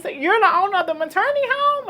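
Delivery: high, amazed question; impressed. Retold or imitated. Social worker asking about the owner, impressed. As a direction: starstruck disbelief. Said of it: "She's like".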